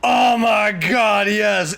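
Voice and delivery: excited shout. Real, not acted. Fan reacting to a reveal.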